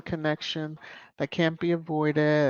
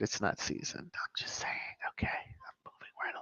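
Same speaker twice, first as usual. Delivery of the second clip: whispered catty aside; real gossipy whisper; conspiratorial whisper; 8 semitones lower, 14 dB quieter than usual. Real, not acted. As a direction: drop to a sly whisper, like telling a secret. Gossipy aside about Meghan's food.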